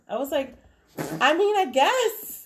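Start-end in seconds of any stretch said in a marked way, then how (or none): 1.2-2.4 high, loud, drawn-out uncertain answer; baffled reply. Retold or imitated. Mom answering daughter's brownie question, high baffled voice. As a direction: squeak it up in bewilderment.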